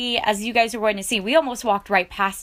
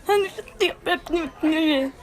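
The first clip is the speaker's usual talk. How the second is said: high, teary laugh of overwhelm; giddy laugh-cry; 8 semitones higher than usual. Real, not acted. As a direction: let the joy spill into a teary laugh. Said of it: "This is gonna be me for the rest of the video".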